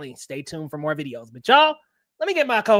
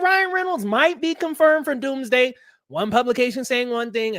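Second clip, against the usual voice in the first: usual speaking voice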